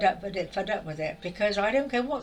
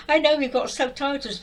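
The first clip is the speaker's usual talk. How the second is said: usual speaking voice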